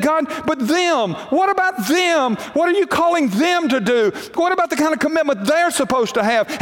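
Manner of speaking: high, rising, near-shouted protest; indignant complaint to God. Acted. A believer protests to God about others, near-shouting. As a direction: demand it of God, hurt and indignant.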